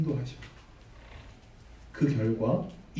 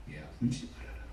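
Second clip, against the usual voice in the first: much lower and softer, gently soothing; tender comfort; 11 semitones lower, 7 dB quieter, faster than usual. Acted. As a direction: comfort a grieving mother softly. Jesus comforts the weeping widow. (낮고 부드럽게 위로하듯)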